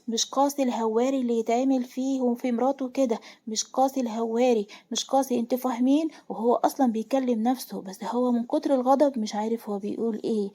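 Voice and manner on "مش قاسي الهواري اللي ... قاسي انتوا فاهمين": plain reading voice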